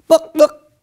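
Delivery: high, loud hen squawk "Book, book!"; chicken clucking. Acted. Chicken asks for books.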